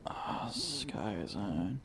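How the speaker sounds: whispering. Real, not acted. Whispering during the phone call.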